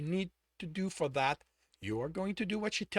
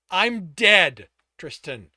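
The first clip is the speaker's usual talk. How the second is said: angry; 2 semitones higher, 14 dB louder, much slower than usual. Acted. Angry mask.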